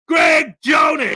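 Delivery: high, slow shout of the name; announcer shout. Acted. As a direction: call the winner out loud. Announcing the first arrival.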